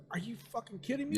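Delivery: high-pitched, quick incredulous outburst; mock outraged disbelief. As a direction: act out a friend who can't believe it. Acting out friend's disbelief at closing time.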